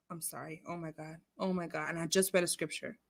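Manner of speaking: low, hushed, sheepish muttering; abashed apology. Real, not acted. Host apologizing for cursing after scripture, hushed and abashed. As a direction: drop right down, embarrassed at yourself.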